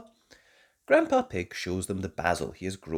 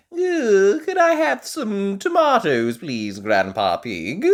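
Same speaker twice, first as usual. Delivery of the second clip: much higher, loud sweet asking; polite granny; 10 semitones higher, 11 dB louder than usual. A narrator's reading. Granny Pig asks Grandpa for tomatoes, high and polite. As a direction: ask nicely like a granny. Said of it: "asks Granny Pig".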